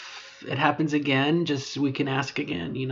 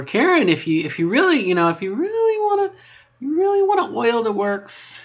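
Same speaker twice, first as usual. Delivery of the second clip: high put-on voice; 9 semitones higher, 5 dB louder than usual. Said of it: "call me Karen".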